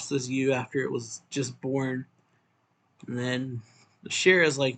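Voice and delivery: ordinary delivery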